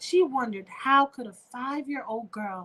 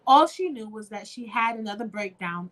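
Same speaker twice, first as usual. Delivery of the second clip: ordinary delivery, nothing marked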